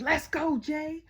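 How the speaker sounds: high, loud fan shout; re-enacted victory yell; excited cheer. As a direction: holler in celebration for your winner. Re-enacts her scream for Jay.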